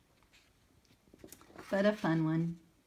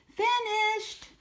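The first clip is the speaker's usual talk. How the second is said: much higher, louder little voice calling out; Sammy calling out; 8 dB louder than usual. Acted. Sammy proudly calls to the teacher that he's finished. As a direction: sing out that he's done. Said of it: "he called".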